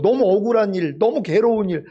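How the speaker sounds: ordinary delivery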